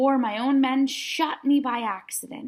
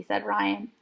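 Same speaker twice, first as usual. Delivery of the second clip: plain reading voice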